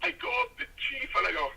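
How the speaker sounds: ordinary talk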